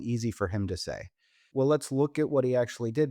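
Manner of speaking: ordinary delivery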